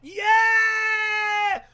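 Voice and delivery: high, loud cheering yell; real burst of fan joy; excited shout. Real, not acted. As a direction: cheer like he just walked out. Cheering the entrance.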